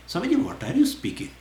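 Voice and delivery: high, baffled question to the teacher; puzzled disciple. Pretend.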